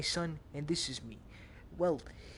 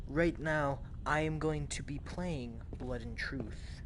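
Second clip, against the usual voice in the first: ordinary talk, nothing marked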